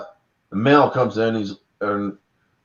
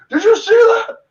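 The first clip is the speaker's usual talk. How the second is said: excited moviegoer; 7 semitones higher than usual. Retold or imitated. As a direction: gush at your doubting friend.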